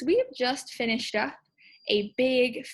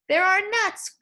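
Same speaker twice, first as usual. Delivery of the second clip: mild mock exclaim; 8 semitones higher, 5 dB louder, slower than usual. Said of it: "exclaimed Bruce".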